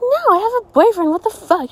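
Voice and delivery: high, loud acted outrage; retelling an outraged rejection; indignant refusal. Acting out refusing a stranger's proposition.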